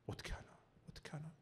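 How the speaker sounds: very soft worried self-talk; retold fearful muttering. Lost preacher recalling his fear in the dark, hushed worry. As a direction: fret quietly under your breath. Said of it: "마음에 두려움이 찾아왔어요".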